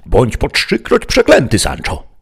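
high, loud curse flung at his squire; enraged knight cursing; furious outburst. A narrator's reading. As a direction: explode at Sancho in exasperation. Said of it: "zawołał Don Quixote".

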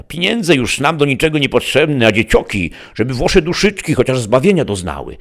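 high, loud granny voice; rustic old mother. A narrator's reading. Mother to her children sharing the wafer, high granny voice. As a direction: pitch it high like a village grandmother.

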